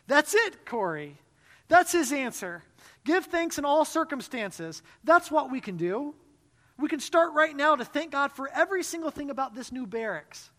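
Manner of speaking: high, loud, bright-eyed eagerness; Betsy thrilled to have the answer; eager. A narrator's reading. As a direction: urge her sister on with joy.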